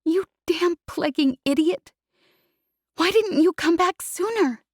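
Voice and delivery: crying scold. Acted. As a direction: scold through tears.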